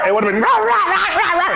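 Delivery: high, barked rah rah like a snarling dog; mock dog growl. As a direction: bark the rahs out like an angry dog. Imitating the dog's growl.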